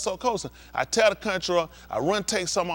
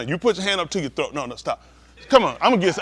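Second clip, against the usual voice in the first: ordinary delivery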